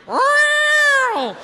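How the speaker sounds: hammed-up straining cat yowl; cat in labour. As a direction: wail like a cat giving birth.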